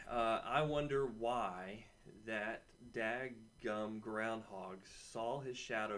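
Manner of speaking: slow, stretched-out grumbling wonder; drawn-out musing. Acted. Host mock-grumbling to students about the groundhog, slow and drawn out. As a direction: drag it out like a mock complaint.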